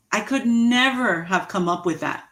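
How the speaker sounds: ordinary delivery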